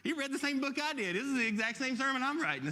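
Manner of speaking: mock-amazed, high and loud; playing his own surprise at the copied sermon; shocked realisation. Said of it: "I'm like".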